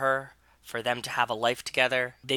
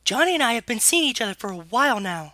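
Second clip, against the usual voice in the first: high, quick put-on girl's voice; Ellie's falsetto confession; 10 semitones higher, faster than usual. Younger sister confessing to the relationship, high and quick. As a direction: rush it out in falsetto.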